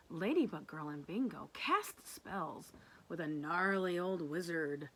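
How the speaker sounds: ordinary talk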